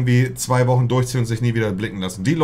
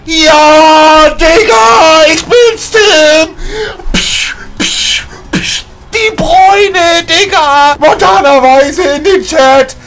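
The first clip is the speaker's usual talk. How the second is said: much higher and louder, wild screaming at the pack; ecstatic shouting; 11 dB louder, much slower than usual. Real, not acted. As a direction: scream with joy as the card is revealed. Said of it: "So viele Tonlagen, Digga".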